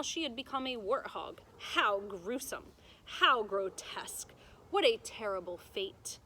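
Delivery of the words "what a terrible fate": plain reading voice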